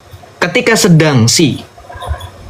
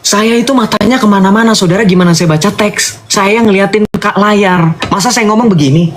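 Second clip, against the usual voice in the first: higher-pitched, exasperated protest; real irritation, pitched up in rhetorical questions; annoyed; 7 semitones higher than usual. Real, not acted. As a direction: push back in a raised pitch, indignant at the accusation. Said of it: "ini gue bikin saya kesel".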